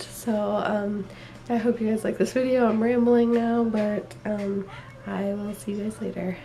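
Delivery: ordinary delivery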